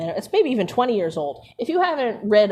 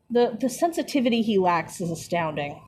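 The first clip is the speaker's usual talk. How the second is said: ordinary delivery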